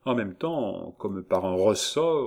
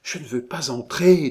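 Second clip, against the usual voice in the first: high, loud protest; acted panicky refusal; frightened refusal; 6 semitones higher, 8 dB louder than usual. A narrator's reading. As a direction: resist in a raised girlish voice. Gervaise struggles to get free.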